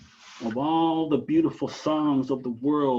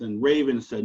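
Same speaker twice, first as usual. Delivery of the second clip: ordinary delivery, nothing marked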